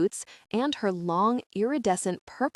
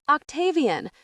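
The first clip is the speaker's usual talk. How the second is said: high-pitched, understated scream of a name; 8 semitones higher, 4 dB louder than usual. A narrator's reading. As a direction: cry out high, a little louder. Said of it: "Ravi screams".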